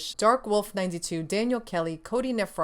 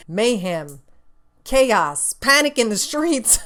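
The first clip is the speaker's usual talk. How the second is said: higher and louder, hammed-up alarm; mock panic; 4 semitones higher, 7 dB louder, slower than usual. Real, not acted. Host dramatizes the preorder frenzy, hammed-up panic. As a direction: cry it out like a mock disaster bulletin. Describing PS5 preorder mayhem.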